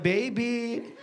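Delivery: soft, cooing offer; sweet baby. Retold or imitated. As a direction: coax him with a fry. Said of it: "They'll be like".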